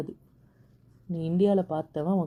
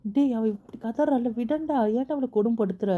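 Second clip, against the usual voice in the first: upset protest; 6 semitones higher than usual. Acted. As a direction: protest on her behalf, pitched high. Brother protests at her being tormented.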